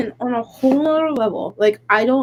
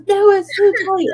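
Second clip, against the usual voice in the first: high, louder whiny protest; toddler voice; 9 semitones higher, 3 dB louder than usual. Retold or imitated. Mom imitating her daughter refusing in the car, whiny protest. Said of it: "She goes".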